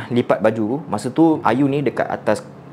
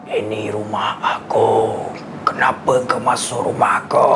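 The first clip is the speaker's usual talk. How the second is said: low, slow, eerie spirit voice; possessing spirit; 4 semitones lower, slower than usual. Acted. Spirit inside the girl claiming the house, low and eerie. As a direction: growl it like the thing inside her. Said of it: "body position terus macam".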